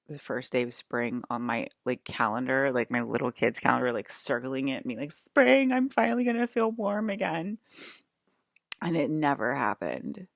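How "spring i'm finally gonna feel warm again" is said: high, loud burst of hope; excited hope. Retold or imitated. Kid circling the first day of spring, excitedly hopeful.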